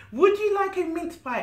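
high, loud bright squirrel offer; cheerful squirrel. Acted. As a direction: offer it eagerly like a little squirrel. Squirrel Tag offers pie.

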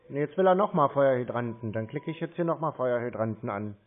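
usual speaking voice

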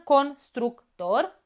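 constructor split into syllables, slow, rising. Shown as a demonstration. Teacher to pupils, slow syllabified constructor. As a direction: sound out constructor, slowly. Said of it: "se despart tot după prima".

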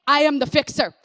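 loud, declaiming voice of God; the voice of God booming out to a hurting believer; God proclaiming. Acted. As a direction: proclaim it with full authority, ringing out. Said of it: "what God's gonna say".

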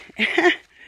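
high burst of laughter; a loud, high laugh; bright laugh. Real, not acted. Laughing about rain.

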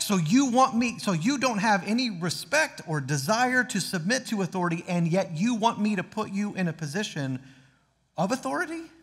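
acted indignant questioning, pitch leaping at the end; mock incredulous. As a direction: play someone baffled by the nerve of it. Acting God's incredulous reply to us.

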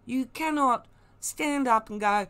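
ordinary talk, nothing marked

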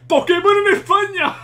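very high, loud and slow, savouring it; ecstatic shout. Real, not acted. Spanish fan celebrating Pokémon set in Spain, ecstatic shout. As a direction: belt it out in pure joy.

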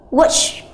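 watch said slowly with a clear final ch; careful demo of watch; teaching pronunciation. Shown as a demonstration. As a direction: model the word watch for students. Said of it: "ví dụ chúng ta đọc động từ".

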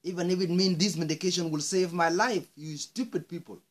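loud, high furious insult; angry outburst. Real, not acted. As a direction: lash out at the doctors. Insults the doctors.

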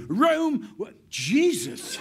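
high, loud startled outburst; mock bellow, breaking off in shock; rip-roaring. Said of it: "He was rip-roaring and ready to go".

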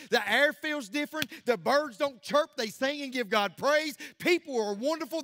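impassioned revival sermon; full-force preaching. Real, not acted. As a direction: drive each declaration out to the whole church.

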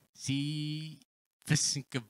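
usual speaking voice

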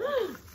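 high, quick gasp; delighted gasp. Real, not acted. Collector unwrapping kissing Claus figures, delighted gasp. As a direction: gasp in happy surprise.

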